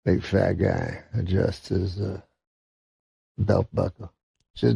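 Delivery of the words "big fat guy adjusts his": normal talking voice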